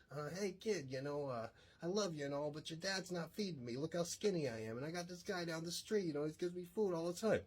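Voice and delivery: put-on a scrawny street cat working you for food, in a New York accent; high, hammed-up New York accent. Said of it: "the cat had a New York accent".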